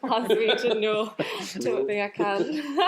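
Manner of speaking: put-on a laughing stab at a Glaswegian accent; half-hearted Glaswegian, giggling through it; asked to turn on a Scottish accent, a shy laughing try. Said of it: "How's your Scottish accent? Can you turn that on?".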